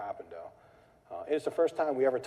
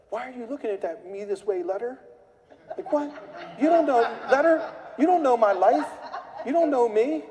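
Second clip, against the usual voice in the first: much higher, much louder hammed-up rant; mock furious homeowner; 11 semitones higher, 8 dB louder than usual. Homeowner yelling at the mailed letter, hammed-up fury. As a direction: shriek at the letter like it insulted you.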